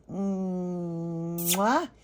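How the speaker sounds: long drawn-out air kiss rising at the end; doll's blown kiss. Pretend. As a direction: blow a sweet kiss for the doll. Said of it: "She's gonna blow you guys a kiss".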